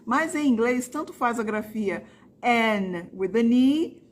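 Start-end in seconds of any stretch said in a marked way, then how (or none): 2.4-4.0 both Annes said the same way, slowly; English Anne, slowly. Shown as a demonstration. Teacher showing students both spellings sound alike, slowly. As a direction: say both in slow English, identical. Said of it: "É a mesma pronúncia".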